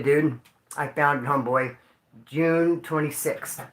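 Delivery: usual speaking voice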